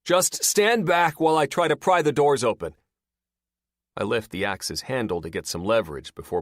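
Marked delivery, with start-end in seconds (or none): 0.0-2.7 loud, higher called instruction; man shouts a warning to the trapped woman; shouting instruction. A narrator's reading. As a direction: call it firmly through the doors.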